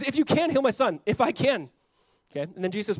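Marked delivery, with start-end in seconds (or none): 0.0-1.7 high, loud repeating with disbelief; incredulous echo. Retold or imitated. Jesus echoing the father's doubt, loud and incredulous. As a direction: throw his words back at him, louder. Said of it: "So he goes".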